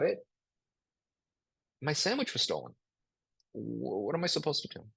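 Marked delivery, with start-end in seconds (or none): 1.8-4.8 resigned futility. Shown as a demonstration. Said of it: "futility in a nutshell".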